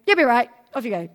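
put-on loud brisk dismissal of a crying kid; brushing off. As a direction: wave the child away quickly. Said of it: "beckon them over and then go".

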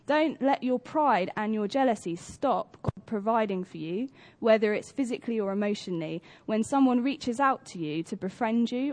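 ordinary talk

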